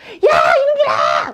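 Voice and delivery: put-on high, loud alarmed yell at the kids; panicked father yelling at his sons; startled shout. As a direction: shout in sudden fright to stop them. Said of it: "너무 놀래서".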